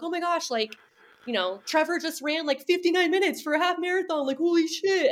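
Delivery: high, excited fan gush; mock excited gasp. Everyone freaking out about the men's time, imitated in a high gush. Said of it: "They're like".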